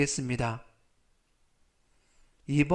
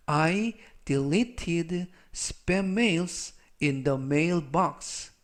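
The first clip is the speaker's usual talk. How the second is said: very slow, word by word English; slow dictation reading; much slower than usual. Shown as a demonstration. Teacher reads the example sentence for students, very slow. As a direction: read it out slowly so learners follow.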